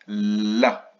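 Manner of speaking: LA with a long held L; syllabe isolée. Shown as a demonstration. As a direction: hold the L before La. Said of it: "on reprend la". (« La » avec un L prolongé)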